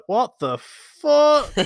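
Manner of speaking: slow, high drawn-out disbelief; retold disbelief. Driver baffled by a customer hanging up, slow disbelief. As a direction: stretch it out, baffled. Reaction to rude hang-up.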